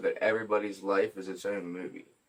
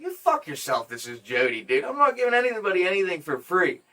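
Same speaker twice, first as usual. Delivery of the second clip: higher, mock-indignant comeback; retold defiant refusal; 9 semitones higher, 6 dB louder than usual. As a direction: tell off a supposed prank caller, louder toward the end. Retold angry refusal on phone.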